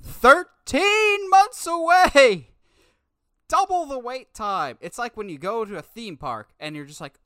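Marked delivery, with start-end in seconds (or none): 0.0-2.5 high, loud, slow exasperation; outraged. Real, not acted. Complaining about the delay to his co-host, high and outraged. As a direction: wail the number in disbelief.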